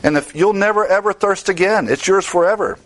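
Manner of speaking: "never ever" lifted high and leaned on; "It's yours forever" rising with assurance; emphatic never ever. Shown as a demonstration. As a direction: preach "never ever thirst again" with full conviction. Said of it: "It's emphatic".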